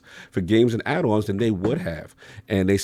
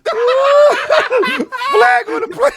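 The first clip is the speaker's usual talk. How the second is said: stretched-out mock ref yell, much higher and louder; mock referee call; 5 dB louder, much slower than usual. Cohost echoing the joke as a laughing referee, hammed-up yell. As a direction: bellow it like a ref through laughter.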